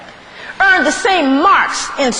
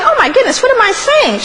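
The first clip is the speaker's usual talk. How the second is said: mother suddenly remembering herself; sudden flustered realization; 5 semitones higher than usual. Acted. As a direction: catch yourself mid-brag, flustered.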